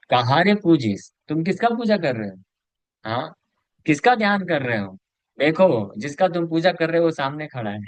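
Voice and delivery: calling out. Retold or imitated. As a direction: demand an answer through the door. Mahaprabhu calling at Srivas' door.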